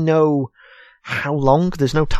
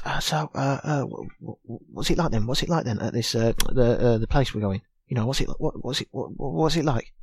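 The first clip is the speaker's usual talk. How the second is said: soft, halting question; hushed, hesitant, repeating herself; young girl; 7 dB quieter than usual. Acted. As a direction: stammer it out quietly like an unsure young girl. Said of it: "The human female, who is only a young girl, leans over and goes".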